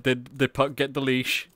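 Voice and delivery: usual speaking voice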